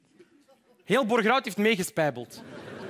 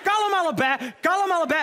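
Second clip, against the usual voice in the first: high, raised mock-exasperated voice; exasperated calling out; 9 semitones higher, 4 dB louder than usual. Husband to wife and Greta, exasperated shout. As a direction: shout for calm over the din.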